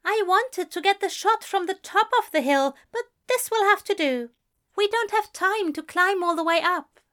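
prim colleague annoyed by delay; fussy producer. A narrator's reading. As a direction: complain while hurrying him. Voices Aziraphale.

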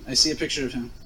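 usual speaking voice

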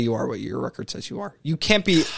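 ordinary talk, nothing marked

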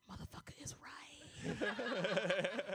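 soft slow whispered aside; laughing whisper. Retold or imitated. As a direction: whisper it like the brother agreeing. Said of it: "he was like".

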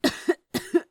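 a short, breathy cough after a drag of smoke; small unvoiced cough from the smoke. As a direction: cough lightly on the exhale. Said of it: "he coughed a bit".

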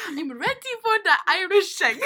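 high Irish accent through laughter; giggly, badly faked Irish; attempted Irish accent. Shown as a demonstration. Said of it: "in an Irish accent".

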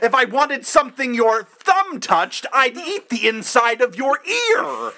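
high, drawn-out mock-outraged yell; loud, hammed-up movie-quote shout. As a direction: yell the quote slowly at full tilt. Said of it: "I didn't mean to yell".

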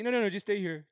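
high, loud pleading no no no; clingy pleading. Acted. Acting a crush who begs the guy to stay, high loud plea.

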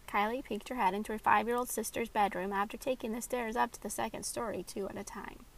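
usual speaking voice

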